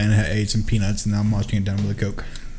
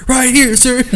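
soldier; 4 dB louder, slower than usual. Pretend. Answers as a private.

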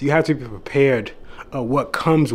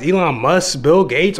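ordinary talk

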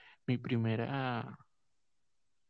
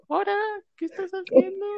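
put-on high, loud little boy yelling; child's voice; 10 dB louder than usual. Cousin catches him kissing, shouted in a kid's voice. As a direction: squeal it like a small boy tattling. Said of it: "era una voz de niño".

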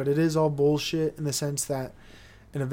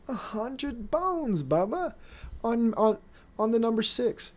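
big bettor; 7 semitones higher than usual. Retold or imitated. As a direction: toss off the bet like a showy gambler. Imitating a big-money bettor.

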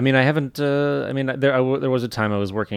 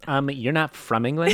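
put-on raised American-accented questioning; 6 semitones higher, 4 dB louder than usual. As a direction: imitate a puzzled query in an American accent. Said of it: "Yeah, in an American accent".

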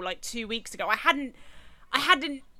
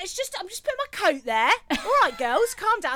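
lightly mocked pushy bloke, pitched up; retold brush-off in a higher voice; dismissive man, imitated; 7 semitones higher than usual. Imitating the intruding man at pub.

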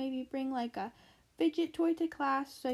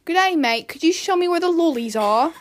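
put-on loud, higher-pitched Australian accent; 4 semitones higher, 11 dB louder than usual. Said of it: "I'm gonna do an Australian accent".

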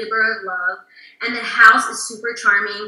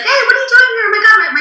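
excited friend's greeting; 13 semitones higher, 7 dB louder, faster than usual. Retold or imitated. Friend quoted greeting her.

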